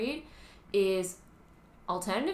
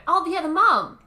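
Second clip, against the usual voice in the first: loud, high burst of recognition; delighted raised-voice exclamation; sudden excited recall; 8 semitones higher, 12 dB louder than usual. Real, not acted. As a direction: blurt it as it comes back to you. Suddenly remembers another cast member.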